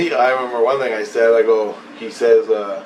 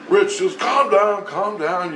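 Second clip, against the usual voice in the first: raised voice urging calm; retold intervening; 8 semitones higher, slower than usual. Other voice breaking up the quarrel, intervening. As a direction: step in with a higher, acted appeal.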